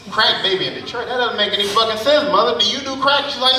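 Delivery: usual speaking voice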